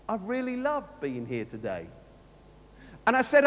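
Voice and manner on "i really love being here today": low, softer and dull, meaning the opposite; flat sarcastic. Shown as a demonstration. Vicar showing the same words mean different things, deadpan. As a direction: say it so nobody believes you. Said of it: "the same words to mean a completely different thing".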